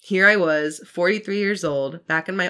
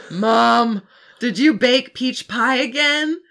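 higher, louder call down the stairs; 7 semitones higher, 9 dB louder, slower than usual. Acted. Sammy calling to his mom about the pie, loud call.

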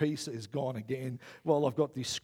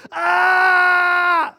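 long, loud high-pitched scream; panicked scream; 16 dB louder than usual. Retold or imitated. Preacher acts out his message to the board, panicked scream. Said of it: "I sent them a message that said".

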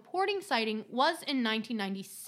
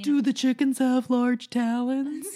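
slow, loud character voice; slow loud put-on voice; quoted movie line; 7 dB louder, slower than usual. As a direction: deliver it like a deadpan movie oddball. Quoting a show line in character.

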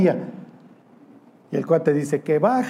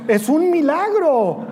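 high, slow, put-on voice of the excuse-making priest; Aaron feigning amazement at his calf; mock Aaron; 9 semitones higher, much slower than usual. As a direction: ham up a fake-innocent miracle cry.

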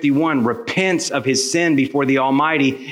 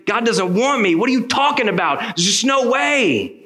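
high-pitched retold outburst, slowing on the last words; agitated protest; 10 semitones higher than usual. Man refusing the pastor's invitation to call out to Jesus, agitated protest. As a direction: protest high and insistent, drawing out the ending.

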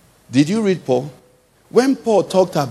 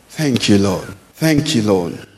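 ordinary talk, nothing marked

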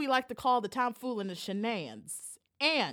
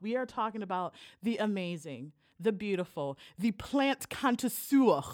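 ordinary talk, nothing marked

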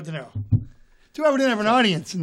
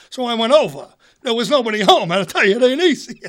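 put-upon comedian impression with loud bursts; Rodney Dangerfield; 2 semitones higher, 4 dB louder than usual. Old-school comic griping about no luck with girls, exasperated. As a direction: complain in punchy one-liners. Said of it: "Rodney's beautiful".

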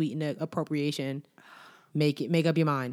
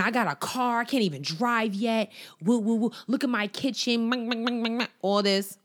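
put-on bragging girl; 6 semitones higher, slower than usual. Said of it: "talking about how she got a car".

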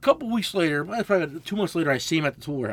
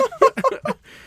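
a high, loud laugh breaks out; burst of laughter; 7 dB louder than usual. Real, not acted. Cracking up at his friend's tool-truck story, a high loud laugh.

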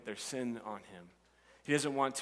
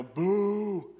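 loud mock boo, pitched up; 6 semitones higher, 5 dB louder than usual. The crowd booing the tax collector, loud and jeering. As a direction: jeer the villain like a crowd. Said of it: "they might go".

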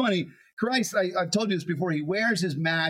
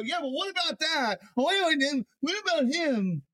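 mock whining; 10 semitones higher than usual. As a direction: whine it like a jealous disciple. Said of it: "you hear the whininess almost in it".

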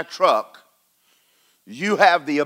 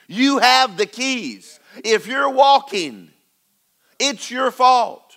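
slow fervent preaching; 5 semitones higher, slower than usual. Real, not acted. Preaching peak, slow and high.

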